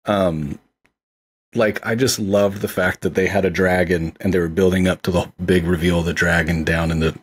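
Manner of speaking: ordinary delivery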